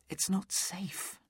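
slow urgent whisper. Acted. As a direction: breathe it out slowly, no voice. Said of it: "she said".

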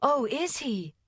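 high, delighted exclamation; rapturous cry. A narrator's reading. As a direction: cry out in sudden joy, pitched high. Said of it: "cried Polly".

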